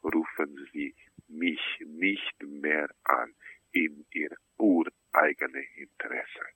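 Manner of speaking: soft, low, restrained warning; hushed pleading. Real, not acted. Sculptor begging caller never to ring again, hushed.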